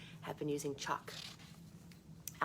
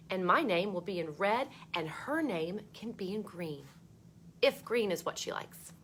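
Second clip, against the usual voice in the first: put-on bright read-aloud voice for children; storybook reading; 6 dB louder than usual. A narrator's reading. Teacher reading a book to kids, lively read-aloud.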